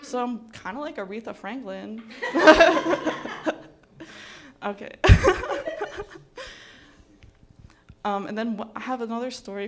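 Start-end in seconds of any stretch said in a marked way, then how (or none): 2.1-3.7 loud, high burst of laughter. Real, not acted. Laughs at her own joke. 4.9-6.5 high, loud giggling fit; laughter. Real, not acted. Laughs at her own joke.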